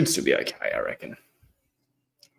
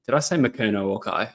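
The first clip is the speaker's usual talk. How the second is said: ordinary talk, nothing marked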